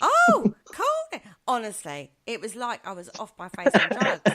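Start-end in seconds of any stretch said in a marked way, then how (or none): 0.0-1.3 high, drawn-out sudden remembering; as if it just dawned through a fog; high, loud mock surprise at the baby's name; dazed realisation.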